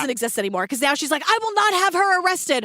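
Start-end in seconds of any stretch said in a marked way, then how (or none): 1.3-2.6 raised pitch, mildly yelled imitation; mock screaming. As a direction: imitate her insisting in a high, indignant voice. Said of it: "screaming to the cops".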